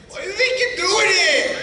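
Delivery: high, loud shout at the volunteer; exasperated shout. Real, not acted. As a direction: yell it out, half laughing. Hypnotist yelling at volunteer.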